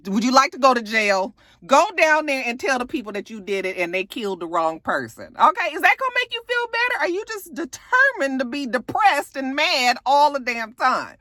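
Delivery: high, fast exasperated rant. Real, not acted. Recapper ranting at A J in her head, high and fast. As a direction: rattle it off at him, pitch climbing. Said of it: "I'm thinking to myself".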